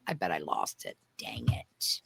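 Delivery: whispered under the breath; whispering. Real, not acted. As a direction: mutter it to yourself in a whisper. Muttering to self, lost file.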